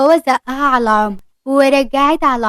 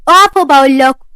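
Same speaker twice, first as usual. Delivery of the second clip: loud call to stop them; shouting stop; 8 dB louder than usual. Acted. Omar shouting at the fleeing pair, loud. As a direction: yell after them, much louder.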